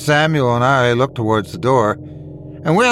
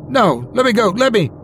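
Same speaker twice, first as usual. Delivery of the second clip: high-pitched cry to be released; panicked plea; 9 semitones higher than usual. A narrator's reading. Bully engulfed by ghostly hands, panicked plea. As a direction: plead high and urgent, as if being dragged off.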